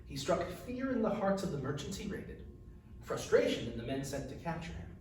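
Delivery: usual speaking voice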